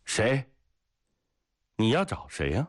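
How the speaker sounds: high, sharp challenge from the train door; a sentry's high call; calling out sharply. A narrator's reading. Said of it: "喊道".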